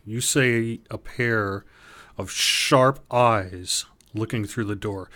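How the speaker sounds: ordinary talk, nothing marked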